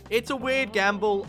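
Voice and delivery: usual speaking voice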